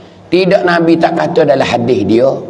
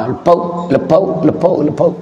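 quick series of higher-pitched pops; imitated firecracker bangs; 3 semitones higher than usual. Preacher imitates firecrackers in mid Ramadan, popping sound effects. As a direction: repeat it like firecrackers going off.

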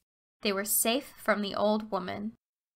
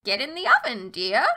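witch voice; 4 dB louder than usual. A narrator's reading. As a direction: sweetly urge her into the oven. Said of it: "said the old woman".

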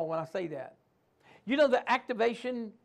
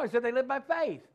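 ordinary delivery

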